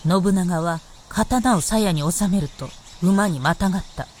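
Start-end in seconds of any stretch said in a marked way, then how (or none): none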